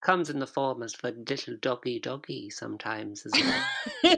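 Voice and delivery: mock spooky voice. Spooky persona teasing cohost.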